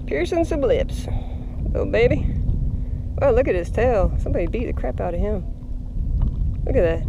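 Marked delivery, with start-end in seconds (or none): none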